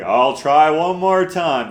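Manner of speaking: exaggerated high, loud sarcastic drawl. Reading a viewer's comment back to them, very sarcastically. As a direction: stretch it out with heavy mock sarcasm. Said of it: "said very sarcastically".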